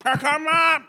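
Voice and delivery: high-pitched yell; high, hammed-up shout; shouted catchphrase. Shouted catchphrase for a fantasy pick.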